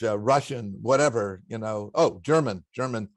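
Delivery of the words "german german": normal talking voice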